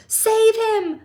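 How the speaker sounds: high, loud panicked scream. A narrator's reading. Mother screaming as son falls in river. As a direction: shriek for someone to help. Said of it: "screamed Missus Gloop".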